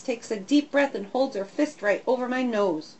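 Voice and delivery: plain reading voice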